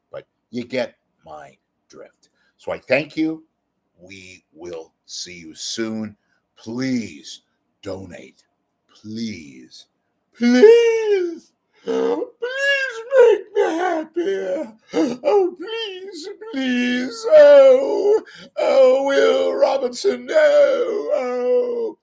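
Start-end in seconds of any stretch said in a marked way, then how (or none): 4.0-9.9 slow plea. Acted. 10.4-22.0 high, loud, hammed-up sobbing plea; theatrical falsetto begging cry; mock wailing.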